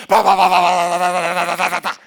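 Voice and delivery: loud rapid-fire wingbeat noise; parrot flying noisily. Retold or imitated. Parrot flew across the room, loud flapping imitation. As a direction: rattle out frantic flapping with the mouth. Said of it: "And the parrot flew across the room".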